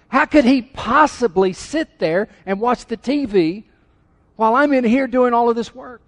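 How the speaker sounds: high, loud indignant complaint; upset wife. Acted. Wife complaining about husband watching TV, upset. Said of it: "gotten upset at your husband like that".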